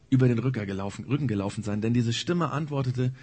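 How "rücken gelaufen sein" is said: usual speaking voice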